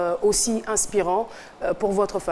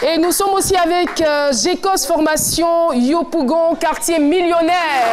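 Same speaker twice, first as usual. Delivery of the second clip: show host hype; 6 semitones higher than usual. Acted. TV host welcoming guests to the studio, hyped announcer voice. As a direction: greet the studio crowd like a game-show host.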